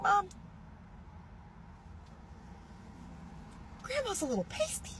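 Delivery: high, soft teasing voice; mock teasing. Telling mom about great grandma's picture, high and teasing. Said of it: "I was like".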